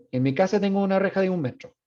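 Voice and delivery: Chilean intonation. Shown as a demonstration. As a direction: say it with a Chilean lilt. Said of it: "Muy chileno".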